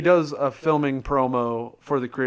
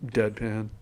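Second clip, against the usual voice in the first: ordinary delivery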